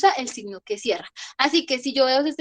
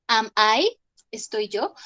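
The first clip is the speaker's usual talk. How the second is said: ordinary talk, nothing marked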